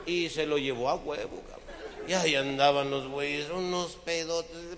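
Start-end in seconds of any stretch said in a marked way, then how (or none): none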